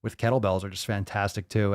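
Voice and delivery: normal talking voice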